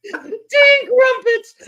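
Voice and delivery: high, loud, drawn-out mock British accent. Host mocking the worst British accent, high hammed-up imitation. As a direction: mimic the awful British accent, high and slow. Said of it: "in the worst accent I've ever heard".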